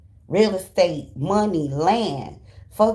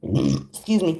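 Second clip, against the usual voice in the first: normal talking voice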